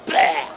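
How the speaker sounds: short blurted retching noise; sudden comic "blah" of spewing; mock projectile vomit. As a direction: imitate a baby throwing up explosively. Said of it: "The projectile type".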